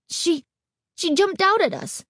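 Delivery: put-on shaken boy. A narrator's reading. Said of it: "he told his mother".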